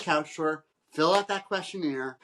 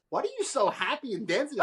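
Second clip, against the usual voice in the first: curious bride; 9 semitones higher, 4 dB quieter than usual. Retold or imitated. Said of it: "my bride goes".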